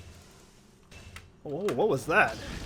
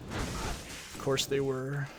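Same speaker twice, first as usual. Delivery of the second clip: sarcastic drawl; 8 dB quieter, much slower than usual. Real, not acted.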